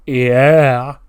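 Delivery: loud, long yelled yeah; crowd yeah. Retold or imitated. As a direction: bellow it for the crowd. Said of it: "can you get me a".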